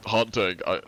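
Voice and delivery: ordinary talk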